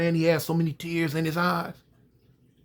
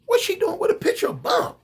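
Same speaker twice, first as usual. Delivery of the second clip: shocked. Acted. Son shocked at the photo.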